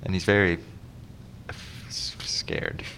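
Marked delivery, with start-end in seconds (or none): 1.5-2.8 whispered stutter. Pretend. Acting out scared stutter.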